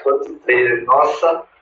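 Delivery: ordinary talk, nothing marked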